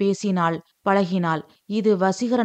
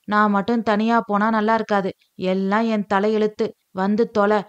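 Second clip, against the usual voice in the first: plain reading voice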